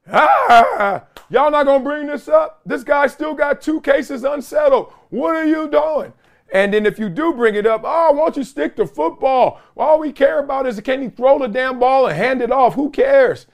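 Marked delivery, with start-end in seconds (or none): 0.1-6.1 an outraged fan letting the network have it; angry fan. Retold or imitated. Fan yelling at a network for ducking the story, indignant high shout. 7.8-13.5 annoyed fan. Retold or imitated. Other fans scolding the coverage.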